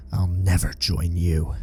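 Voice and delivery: through gritted teeth, low and slow; strained through clenched teeth. A narrator's reading. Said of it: "Through gritted teeth".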